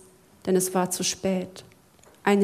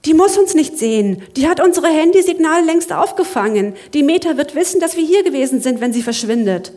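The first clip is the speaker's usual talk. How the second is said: higher, louder and rushed; panicked; 6 semitones higher, 7 dB louder, faster than usual. Acted. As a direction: blurt out the danger fast. Zack panics about surveillance.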